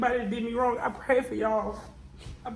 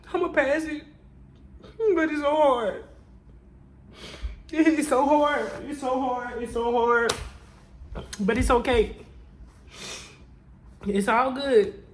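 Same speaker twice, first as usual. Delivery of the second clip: tearful strain; 3 semitones higher than usual. Real, not acted. Emotional vlog, near tears.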